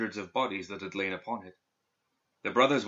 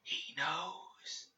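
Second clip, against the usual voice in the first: slow, breathless whisper from far off; ghostly whisper; 8 semitones higher, 11 dB quieter than usual. A narrator's reading. Dead student's voice reaching Perdita's mind, ghostly whisper. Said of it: "it said".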